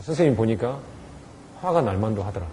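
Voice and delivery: soft, understanding admission; acknowledging. Shown as a demonstration. Teacher softly acknowledges the child's anger. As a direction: validate the child. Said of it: "그거 인정해줘야 돼요".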